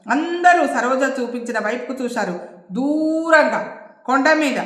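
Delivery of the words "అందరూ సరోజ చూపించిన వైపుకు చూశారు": plain reading voice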